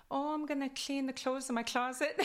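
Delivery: Kwakwala accented English. Shown as a demonstration. Answering friend about cleaning, laughing Kwakwala accent. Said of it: "So my accent".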